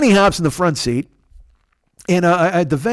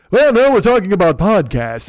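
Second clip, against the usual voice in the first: imitating friend's voice; 5 semitones higher, 4 dB louder than usual. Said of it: "I'm trying to do his, on the mountain voice".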